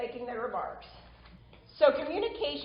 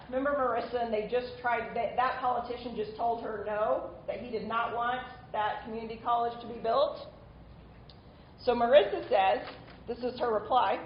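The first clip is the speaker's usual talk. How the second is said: ordinary talk, nothing marked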